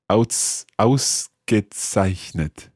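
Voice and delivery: Ausgezeichnet said slowly, syllable by syllable. Shown as a demonstration. Learner slowly sounding out Ausgezeichnet syllable by syllable. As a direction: stretch out Aus-ge-zeich-net slowly. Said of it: "take it syllable by syllable".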